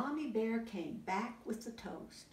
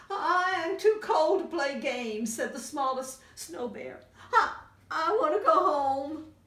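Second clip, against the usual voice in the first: high, louder plaintive cub; high whiny cold cub; 8 semitones higher, 8 dB louder than usual. Acted. Smallest snow bear begging to go home, high and plaintive. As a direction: whimper it high like a frozen cub.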